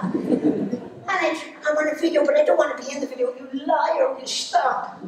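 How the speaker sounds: high Donegal mammy, fast in the middle; mother insisting on video, high Donegal accent. Retold or imitated. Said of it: "full accent".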